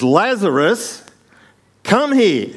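high, drawn-out commanding shout; very loud voice. Acted. Jesus calling dead Lazarus out of the tomb, very loud shout. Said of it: "in a very loud voice".

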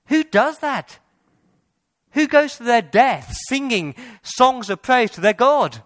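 fervent preacher climax; impassioned rising preach. Real, not acted. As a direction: pour it out, awed and urgent. Preacher builds to passionate climax.

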